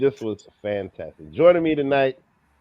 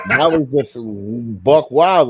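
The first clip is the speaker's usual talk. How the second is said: excited; 5 semitones higher, 12 dB louder, slower than usual. Real, not acted. Recalls the punch, delighted.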